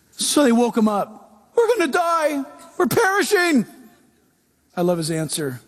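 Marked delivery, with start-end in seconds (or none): 1.5-3.7 high mock panic; high frightened cry; panicked disciples. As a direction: cry out as if the boat is sinking.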